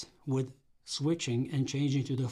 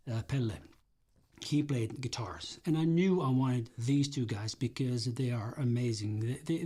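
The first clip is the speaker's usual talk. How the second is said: usual speaking voice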